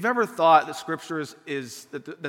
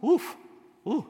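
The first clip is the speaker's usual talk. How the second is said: normal talking voice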